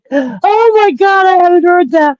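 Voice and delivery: genuine stunned disbelief; shocked. Real, not acted. Shocked reaction to court news.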